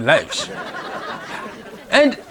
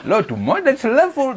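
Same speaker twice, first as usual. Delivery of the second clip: incredulous; 7 semitones higher than usual. Real, not acted. Incredulous echo of army phrase.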